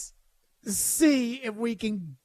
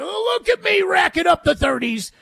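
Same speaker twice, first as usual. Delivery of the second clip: higher, louder bragging meathead voice; mock gym meathead; 6 semitones higher, 4 dB louder than usual. Host mocking a meathead posting mirror selfies, loud bragging. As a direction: brag about the lifting like a gym bro. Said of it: "kinda like your local meathead".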